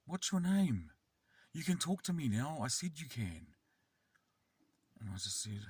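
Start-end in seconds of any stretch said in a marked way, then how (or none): 0.1-3.5 high, pushy imitation of a bossy kid; insistent child. Kid pressing the silent carer to answer, high and insistent. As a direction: repeat the demand in a raised child's pitch. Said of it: "And he said".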